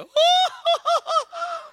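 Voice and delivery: high, slow, loud operatic ha-ha; hammed-up soprano laugh. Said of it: "Até a risada dela é lírica". (risada lírica exagerada, bem aguda)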